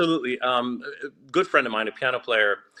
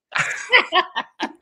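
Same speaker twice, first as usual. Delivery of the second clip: high burst of laughter. Real, not acted. Laughs at own story.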